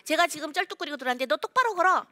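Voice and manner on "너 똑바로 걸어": sharp, higher and louder command to walk straight; scolding parent. Retold or imitated. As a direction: bark it like a nagging parent. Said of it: "이렇게 하면".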